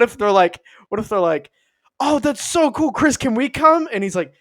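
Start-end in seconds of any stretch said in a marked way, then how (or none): 2.0-3.9 high, loud, eager fanboy gushing; hammed-up excited hangers-on; fawning. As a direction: beg to tag along, starstruck. Castmates fawn over Pratt.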